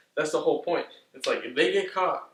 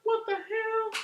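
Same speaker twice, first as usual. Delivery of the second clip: much higher, squeaky mock disbelief; squeaky shocked voice; much slower than usual. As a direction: squeal it out like a startled cartoon. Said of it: "they're just like".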